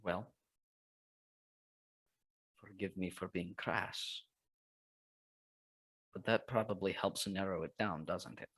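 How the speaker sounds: gentle caution. Acted. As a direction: ease into a touchy point. Careful gentle suggestion.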